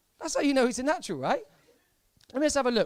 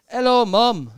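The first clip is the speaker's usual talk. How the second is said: loud mock [name] hello; 12 dB louder, much slower than usual. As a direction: boom it out like a pop star on stage. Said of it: "That's sort of how he speaks".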